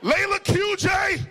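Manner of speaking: high, loud, drawn-out name roll-call; mock parental shout, pitch way up; calling out children. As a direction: holler the names like an accusing parent. Parent calls out kids' names.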